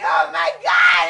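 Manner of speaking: loud wailing cry of shock; anguished outcry. Real, not acted. Daughter struck by what she is seeing, anguished outcry. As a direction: cry out in horror. Witnessing mother dead, crying out.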